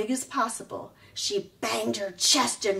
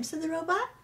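ordinary delivery

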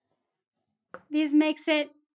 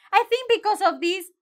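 ordinary talk

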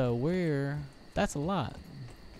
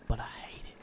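whispered aside; 6 dB quieter, faster than usual. Real, not acted. Player spooked by a strange sound, whispering. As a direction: breathe it out, no voice.

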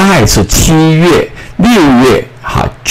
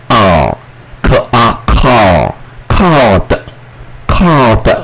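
slow blending; much slower than usual. Shown as a demonstration. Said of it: "teacher blends call slowly".

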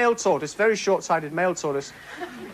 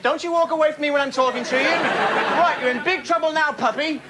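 raised, mock-stern telling off of a dog; firm puppy scolding; 7 semitones higher than usual. Comic scolding her puppy over chewed slippers, mock-stern. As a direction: scold the puppy like a strict parent, hammed up. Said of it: "I believe in the firm hand approach with a puppy".

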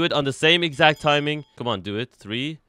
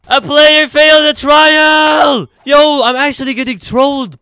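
high, very loud exasperated yell; outraged shouting; 12 semitones higher, 13 dB louder than usual. Real, not acted. Player learning the trial failed through trolling, outraged high shout. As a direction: explode into a high, loud complaint.

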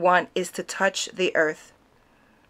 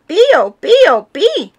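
high, loud bird chirp; canary chirps; 10 semitones higher, 8 dB louder than usual. A narrator's reading. The canary answering Missus Andesola, chirping high.

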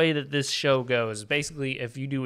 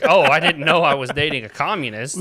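loud, feigned shock; joking, loudly affronted accusation; mock indignation; 8 dB louder than usual. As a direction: act scandalized by your partner. Mock reaction to partner's opinion.

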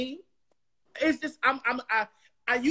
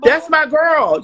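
much louder and higher, proud; loud excited claim; 6 semitones higher, 14 dB louder, slower than usual. Real, not acted. Guest proudly claims her friend, loud and excited. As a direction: shout her friend's name with pride.

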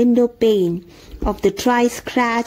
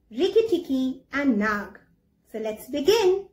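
put-on talking to children; 2 semitones higher, 7 dB quieter than usual. Teacher greeting her young pupils, soft and bright. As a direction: coax young pupils in gently.

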